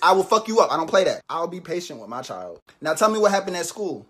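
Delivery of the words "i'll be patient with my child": soft, slow, low calm; forced calm. Acted. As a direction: talk yourself down. Mom forces herself calm.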